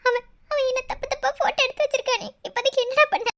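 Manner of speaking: high-pitched sobbing plea; crying. Acted. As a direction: blurt it out through tears. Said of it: "நீ எதுக்கு அழுதுட்டு இருக்க". (அழுகையோடு புலம்பி)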